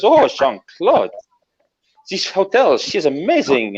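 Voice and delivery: high-pitched French admirer, drawn-out name; swooning Frenchwoman. Acted. Woman to the fighter pilot on his car, swooning. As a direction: coo it high in a French accent. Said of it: "And she goes".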